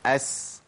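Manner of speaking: أس with a soft hissing S; sin sound demo. Shown as a demonstration. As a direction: hiss the S in أس lightly. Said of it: "أقول".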